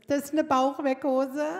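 said through laughter; laughing. Retold or imitated. Saleswoman handing over shapewear, laughing.

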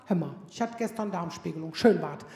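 low, deadpan mock enthusiasm; low ironic rave about a colonoscopy; mock patient. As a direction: play a patient who oddly loved it. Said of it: "der kommt und sagt".

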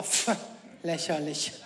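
low dismissive scoff. Retold or imitated. Mother scoffing at her son's challenge, low and dismissive. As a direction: brush it off with contempt.

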